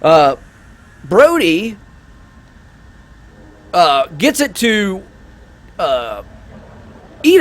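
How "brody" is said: ordinary talk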